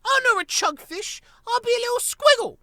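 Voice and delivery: very high, squeaky, loud protest; a tiny child standing on dignity; indignant baby squirrel. Acted.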